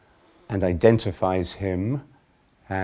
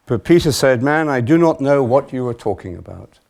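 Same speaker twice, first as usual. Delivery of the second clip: loud, higher, emphatic denial; projected Gospel narration; scripture reading; 5 semitones higher, 8 dB louder than usual. A narrator's reading. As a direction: read Peter's denial out forcefully. Reads Peter's denial aloud.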